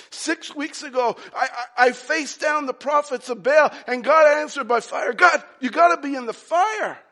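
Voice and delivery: high, loud, building to a shout; excited Elijah. Acted. Preacher as Elijah recalls God answering by fire, excited shout. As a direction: insist to God at full force.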